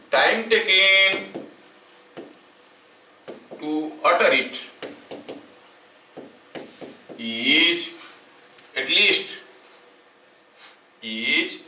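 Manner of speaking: slow, word-by-word dictation with long pauses; dictating notes. Acted. Teacher dictating a definition to the class, slow dictation. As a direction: dictate each phrase slowly so students can write it down.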